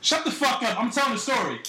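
high-pitched irritated cut-in; exasperated snap. Real, not acted. Storyteller silencing an interrupting co-host, high exasperated snap.

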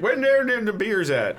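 high, drawn-out oaf calling for drink; hammed-up imitation of an embarrassing loudmouth, pitched high.

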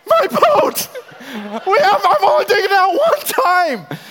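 high, loud laughing wail; hammed-up heartbroken yelp; mock distraught cry. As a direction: wail over the lost boat through laughter. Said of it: "my heart is sunk".

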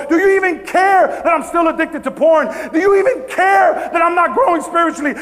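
insistent litany building to a high shout; impassioned preacher acting out desperate prayer; anguished challenge to God. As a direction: cry out each complaint harder, voice climbing.

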